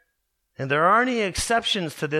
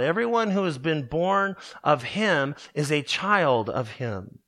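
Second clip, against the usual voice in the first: ordinary talk, nothing marked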